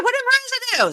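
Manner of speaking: very high-pitched imitation; mock alarmed question. Mimicking reaction to Marissa's name, mock alarm. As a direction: squeal it in pretend disbelief.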